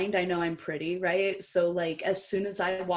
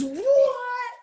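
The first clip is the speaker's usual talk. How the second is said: shocked; 9 dB louder than usual. Retold or imitated. Wrestlers react in shock.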